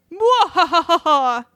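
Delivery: slow, high villain cackle; evil laugh. Acted. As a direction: cackle like a mad scientist. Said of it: "that's creepy".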